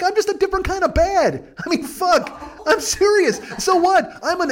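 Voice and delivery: a comic exasperated outburst, voice cracking high; worked-up rant. Real, not acted.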